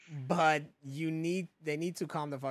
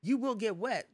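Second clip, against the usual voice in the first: mock warning sign; 7 semitones higher, 3 dB louder than usual. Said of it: "the sign says".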